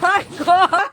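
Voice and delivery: laughing shout of shock. Real, not acted. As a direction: blurt it out, laughing and stunned. Shocked after being hit.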